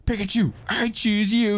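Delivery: imagining a Pokemon trainer throwing a ball, rousing and high; Pokemon trainer call. Pretend. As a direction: call it out like Ash. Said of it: "a Pokemon where they're like".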